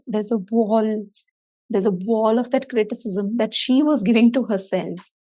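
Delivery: ordinary talk, nothing marked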